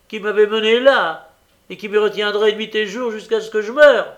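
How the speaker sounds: high, loud, bitter outburst; impassioned fury, pitched high; furious accusation. A narrator's reading. Nancy keeps raging at him.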